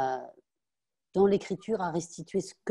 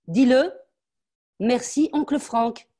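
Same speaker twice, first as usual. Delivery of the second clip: higher and louder, spelling it out; dictated thanks; 8 semitones higher, 7 dB louder, slower than usual. Acted. Mother dictating the thanks to her daughter, higher and louder. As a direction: dictate the words she must say.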